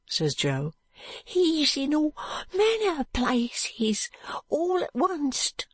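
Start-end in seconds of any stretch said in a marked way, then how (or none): 1.2-5.7 high, slow, frightened whisper; as if the man could hear him; fearful whisper. Acted. Joe whispers his fear.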